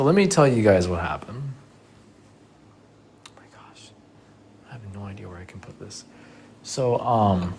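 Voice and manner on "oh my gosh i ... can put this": hushed, quick unvoiced aside; whisper. Real, not acted. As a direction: whisper it so no one nearby hears. Secret aside to viewers.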